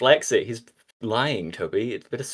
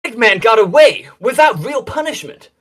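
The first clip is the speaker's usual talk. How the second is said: high shouting Sonic voice; 12 semitones higher, 9 dB louder than usual. Acted.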